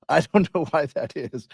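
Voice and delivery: words squeezed through a real laugh. Real, not acted. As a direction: giggle while you say it. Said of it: "I don't know why I'm laughing".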